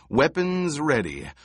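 usual speaking voice

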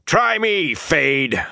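high, ringing shout of defiance; shouted challenge. Acted. Rand dares the Fade to fight him, shouting. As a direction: shout it as a dare to the enemy. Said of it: "Rand shouted".

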